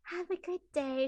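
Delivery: high sweet fairy voice; fairy blessing. Retold or imitated. Fairy to little girl, high sweet parting blessing. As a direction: bestow it like a kind fairy. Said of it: "And I'm like".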